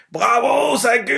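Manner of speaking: an excited shout of congratulations; shouted cheer. Retold or imitated. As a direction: yell it out like a proud friend. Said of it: "prend le micro et crie".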